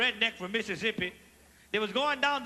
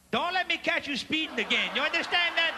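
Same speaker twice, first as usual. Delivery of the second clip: normal talking voice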